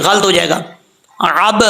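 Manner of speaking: ordinary talk, nothing marked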